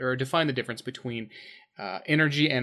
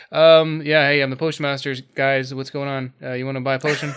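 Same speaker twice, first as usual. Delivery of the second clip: dropped the character, casual; lazy bored NPC; 8 dB louder than usual. Retold or imitated. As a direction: careless mumbled shopkeeper. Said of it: "all of that work is just gone".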